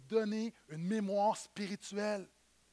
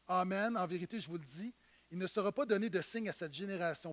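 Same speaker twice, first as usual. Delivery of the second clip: low, much softer, with a weary sigh; 4 semitones lower, 6 dB quieter than usual. Acted. Jesus, sighing deeply, answering the Pharisees wearily. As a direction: sigh it out, tired of them. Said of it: "Jésus soupira profondément".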